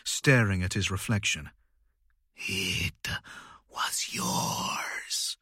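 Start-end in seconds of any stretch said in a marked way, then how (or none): 2.4-5.4 eerie voice from nowhere; ghostly whisper. Acted. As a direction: whisper like a haunting voice. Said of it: "when he heard the voice".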